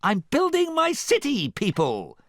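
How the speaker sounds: very high, loud, excited cry; giddy high-pitched proclamation. A narrator's reading. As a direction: burst out with excitement. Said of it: "replied an excited Artie".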